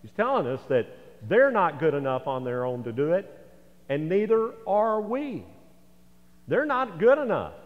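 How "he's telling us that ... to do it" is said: usual speaking voice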